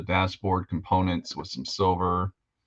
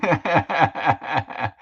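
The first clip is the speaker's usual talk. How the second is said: laugh; 9 semitones higher, 3 dB louder than usual. Real, not acted. Laughing at own joke.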